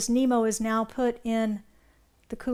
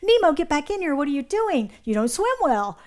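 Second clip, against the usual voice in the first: higher, louder panicked father yelling; frantic dad; 4 semitones higher, 5 dB louder than usual. Acted. Dad yelling at Nemo to come back, frantic. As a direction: call him back in a panic. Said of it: "his dad is frantic".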